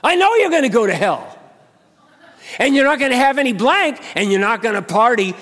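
high, loud warning; preacher warning the scoffer; forceful rebuke. Retold or imitated. Preacher retorting to coworker.

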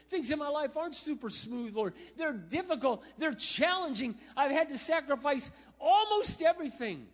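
high, loud, pleading prayer, building up; tearful prayer. Retold or imitated. Driving alone, he cries out to the Lord, high and loud. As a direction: pour out your heart to the Lord. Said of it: "tears are streaming down my face and I'm like".

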